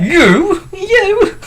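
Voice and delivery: very high falsetto You; mock female voice. Shown as a demonstration. Friend mocks the fox voice in shrill falsetto. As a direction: squeak it like a bad woman voice. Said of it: "we can't do female voices".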